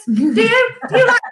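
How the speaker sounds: high, laughing mock interrogation; laughing teasing scold. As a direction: tease her, laughing at each repeat. Mimicking friend's teasing scold.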